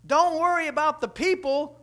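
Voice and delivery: loud, high cry about the horse; urgent yell up at the balcony; excited shout. Retold or imitated. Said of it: "she looks up and she goes".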